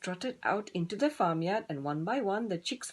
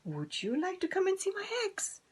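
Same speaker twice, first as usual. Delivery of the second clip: much higher breathy hen ask; hen whisper; 12 semitones higher than usual. Acted. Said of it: "clucked Dora".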